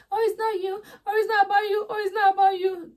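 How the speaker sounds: high, whiny mock sob; mock crying. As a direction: wail it like fake tears.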